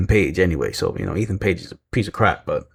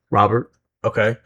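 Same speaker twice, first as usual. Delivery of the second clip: ordinary delivery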